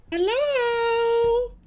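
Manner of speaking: very high, drawn-out hello; playful falsetto greeting. Pretend. Streamer greeting a would-be friend, playful falsetto. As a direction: sing out a squeaky-high hello.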